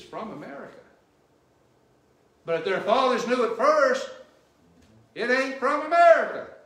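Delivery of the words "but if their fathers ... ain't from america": much louder and higher, full-force preaching; preaching shout. Real, not acted. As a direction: hammer the point home to the congregation. Preacher driving point home.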